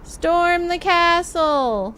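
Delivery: slow, loud, drawn-out charge call; rallying battle cry. Pretend. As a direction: call the troops to storm.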